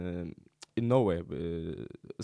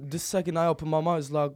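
ordinary talk, nothing marked

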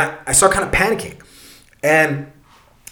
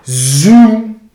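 long, loud "zoom" of a passing light; drawn-out whooshing imitation; imitated whoosh; 2 semitones higher, 7 dB louder than usual. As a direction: stretch it out like something rushing past. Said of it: "and it goes".

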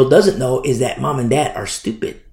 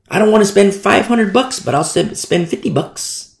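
higher-pitched imitation of a penny-pincher; mock complaint; 7 semitones higher than usual. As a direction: mimic a grumbling cheapskate. Mimics penny-pinching parent.

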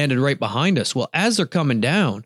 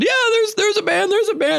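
high excited shout, much higher pitch; excited yelling. Retold or imitated. Hunting buddy yelling out a band he spotted, high and excited. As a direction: cheer about a banded goose. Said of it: "Scotty's yelling".

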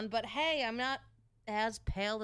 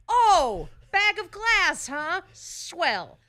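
sarcastic; 8 semitones higher, 10 dB louder, much slower than usual. Retold or imitated. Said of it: "I'm like".

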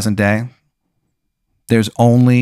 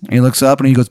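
usual speaking voice

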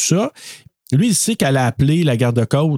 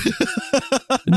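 high squealing laugh; 4 dB quieter than usual. Real, not acted. Laughing at her own joke about the eyes, squealing. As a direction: burst of high-pitched giggling.

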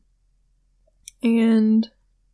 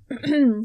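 throat clearing; 3 semitones higher than usual. Real, not acted.

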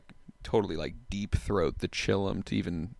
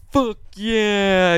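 high, loud, slow drawn-out voice; Michael Lehrer impression; 11 semitones higher, 7 dB louder than usual. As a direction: stretch each word out high and loud. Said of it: "upset by the voice I'm doing".

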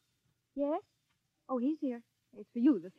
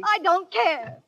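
high-pitched stubborn refusal; defiant; 7 semitones higher, 5 dB louder, much slower than usual. Acted. Pupil refuses the kiss though it's in the play, defiant. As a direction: dig in, voice pitched up. Pupil argues against going on.

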